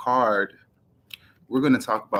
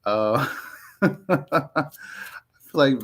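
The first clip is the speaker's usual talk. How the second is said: laughing; 5 semitones higher, slower than usual. Real, not acted. Laughs at her own talking.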